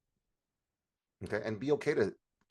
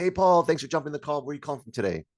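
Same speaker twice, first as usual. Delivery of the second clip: loud, high, cheery greeting; chatty sales-call opener, bursting out high then easing; bubbly chatterbox; 6 semitones higher, 5 dB louder, faster than usual. Shown as a demonstration. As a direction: greet a client like a bubbly people pleaser. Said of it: "she's bubbly, she's charismatic like me".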